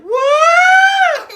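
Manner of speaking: high, loud startled yell; shocked shriek. Acted. Storyteller voices a startled unicorn, high shriek. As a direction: squeal it in cartoon shock. Character's startled reaction to horn.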